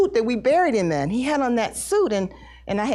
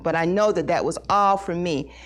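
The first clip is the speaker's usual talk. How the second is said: usual speaking voice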